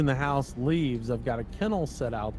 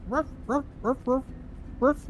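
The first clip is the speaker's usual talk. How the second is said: dog barking; 12 semitones higher than usual. Retold or imitated.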